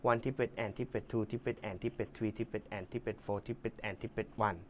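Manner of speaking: counting gently while demonstrating; slow, soft count. Shown as a demonstration. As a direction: take it slowly, one syllable at a time. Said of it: "ค่อยๆดู ค่อยๆทำไปนะ".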